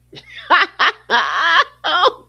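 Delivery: high shrieking laugh; cackling laugh, can't stop; loud laughing fit. Real, not acted. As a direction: burst out laughing.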